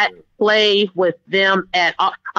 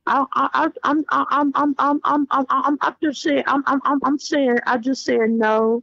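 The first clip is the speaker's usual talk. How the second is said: higher, rapidly repeated stammer; backpedaling son; 3 semitones higher than usual. Retold or imitated. Little boy backing down before mother, nervous stammer. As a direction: stumble over the first word like a child caught out.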